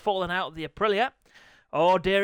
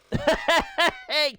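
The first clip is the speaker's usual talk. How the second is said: high rapid giggle; high-pitched laughing fit; cheeky laugh; 9 semitones higher, 3 dB louder than usual. Real, not acted. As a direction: cackle at your own innuendo. Laughs at own innuendo.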